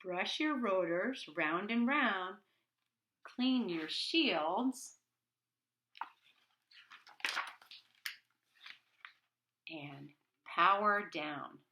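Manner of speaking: slow, sing-song bedtime reading; reading to children. A narrator's reading. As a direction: read it gently like a bedtime rhyme. Reading the rhyming story aloud.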